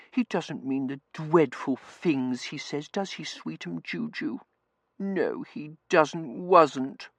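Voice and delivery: high, slow cooing to a pet; cutesy, drawn-out, high-pitched pet voice; baby talk. A narrator's reading. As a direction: coo babyishly at the cat.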